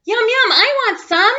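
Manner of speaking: put-on high, loud eager kid; eager begging. Clark begs for ice cream.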